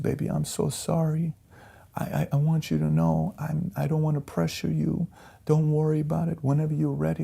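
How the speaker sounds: soft, high voice imitating a wife's apology; imitated upset wife. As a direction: retell her apology small, high and tender. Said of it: "and she's crying".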